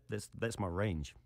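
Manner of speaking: ordinary talk, nothing marked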